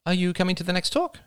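high-pitched woman's voice; inquiring woman. A narrator's reading. Blonde woman greets Oliver at the conference, high inquiring voice. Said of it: "she inquired".

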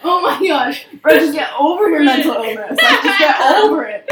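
loud, high, laughing jeer; mock exasperation. Joking with friends about boyfriend's freakout, laughing mock exasperation.

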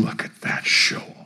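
hissed, scornful aside; sneering whisper. Retold or imitated. A critic in the back row sneering in a whisper. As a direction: whisper a jealous put-down from the back pew.

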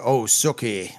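lightly put-on Southern drawl; Southern accent. Co-host imitating True Blood to show his Southern accent. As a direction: mimic a TV Southerner, playfully. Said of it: "get, like, Southern accent at all".

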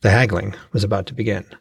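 ordinary delivery, nothing marked

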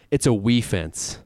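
drawn-out, deadpan delivery of the pun; slowed punchline. Retold or imitated. Reading a coach's pun slowly to his cohost. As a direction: land the pun slowly and flatly. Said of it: "And his quote was".

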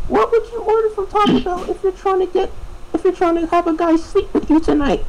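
very high mock-girl falsetto; high put-on female voice. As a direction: imitate a girl customer asking, way up high.

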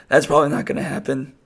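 ordinary talk, nothing marked

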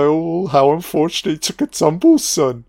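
hammed-up demon jeering the victim; mocking demon voice. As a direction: gloat over the fall like a cruel demon. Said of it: "demon's like".

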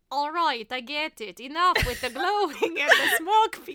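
exasperated bat, building to a shout; Bartok. Acted. Podcaster acting out her favorite bat line, hammed-up exasperation. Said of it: "You're so good at that".

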